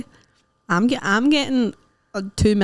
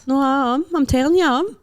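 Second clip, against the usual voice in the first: playful insistence; 5 semitones higher than usual. Real, not acted. Playfully insisting they are good.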